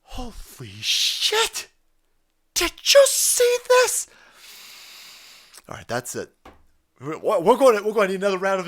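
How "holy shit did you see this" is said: drawn-out gasping shock rising to a high loud squeal; mock shock. Pundit mocking the articles, hammed-up shocked squeal. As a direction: play the headline writer losing it over the pictures. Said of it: "they had articles going".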